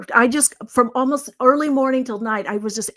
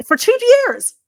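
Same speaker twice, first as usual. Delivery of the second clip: loud, high-pitched astonishment; imitated disbelief; 9 dB louder than usual. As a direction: exclaim it as the amazed client, way up high. Client amazed at no charging, retold.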